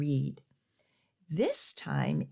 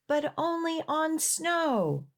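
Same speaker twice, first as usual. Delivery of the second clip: shopkeeper calling out; 9 semitones higher than usual. Acted. Shopkeeper calls after departing dog.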